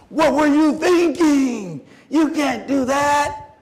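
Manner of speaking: high, loud, drawn-out reprimand; outraged scolding. Retold or imitated. Coach confronting anchor and team captain, outraged scolding. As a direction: stretch each word in loud disbelief.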